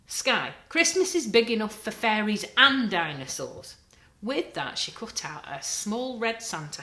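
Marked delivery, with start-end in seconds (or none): 0.1-3.8 loud, firm telling-off; firm mum. A narrator's reading. Mum telling the children off, loud and firm. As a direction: settle the argument with a raised voice.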